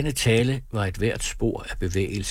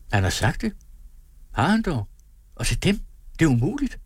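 high, rising incredulous exclamation; crying out in disbelief; 7 semitones higher than usual. A narrator's reading. Sofie learns he has told Møller, incredulous cry. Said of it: "råbte Sofie".

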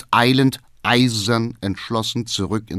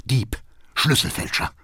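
harsh whispered name-calling; whispered accusation; 4 semitones higher than usual. A narrator's reading. Father calling his son a thief, whispered accusation. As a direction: hiss the insults under your breath. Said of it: "flüstert der Vater".

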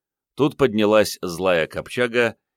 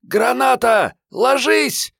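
very high, loud squealed warning; Мариетта's shriek; 5 dB louder, slower than usual. A narrator's reading. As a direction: shriek for everyone to take cover. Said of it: "взвизгнула Мариетта".

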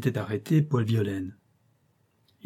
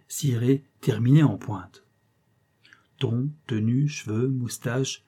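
usual speaking voice

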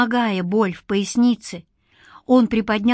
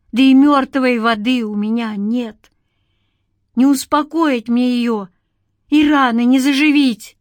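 raised, anguished lament; bitter; 4 semitones higher, 5 dB louder than usual. A narrator's reading. Man with no water to heal her, bitter. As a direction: lament it bitterly, building at the end. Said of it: "горько произнёс Игнат".

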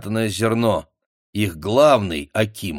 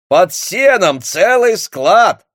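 joyful shout; 11 semitones higher, 9 dB louder, much slower than usual. A narrator's reading. Young fellow shouting discovery.

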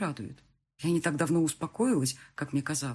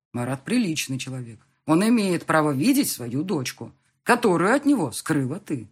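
loud, emphatic fatherly lecture; stern ruling; 6 dB louder than usual. Acted. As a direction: lay down the law firmly. Father sternly lays down his ruling. (строго, веско)

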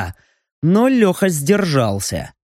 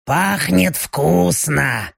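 very slow, hungry demon voice; much slower than usual. Acted. Demon smells prey.

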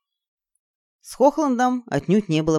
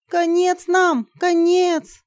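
high-pitched lament; despairing wail; 13 semitones higher, 4 dB louder, much slower than usual. Acted. Izergil moaning in despair, wailing. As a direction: wail that all is lost. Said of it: "причитала она".

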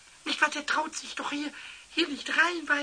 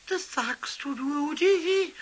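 ordinary talk, nothing marked

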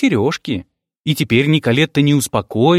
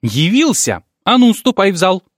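rising angry outburst; angry cry; 6 semitones higher, 4 dB louder than usual. Acted. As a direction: scold him, getting louder. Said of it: "сердито вскрикнула".

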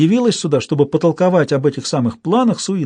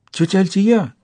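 put-on higher, astonished boy voice; surprised cry; 6 semitones higher than usual. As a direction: call out in sudden amazement. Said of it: "изумился мальчик".

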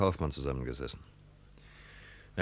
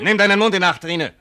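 much louder, higher threat; angry warning; 8 semitones higher, 8 dB louder than usual. Acted. Son-in-law warning his mother-in-law to watch her mouth, angry. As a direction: bark a warning.

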